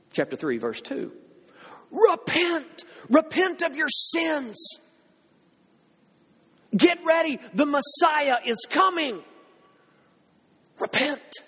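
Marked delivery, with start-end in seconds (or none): 1.9-11.4 calling out. Retold or imitated. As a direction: cry it out like a prophet in the wilderness. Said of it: "He had been calling out".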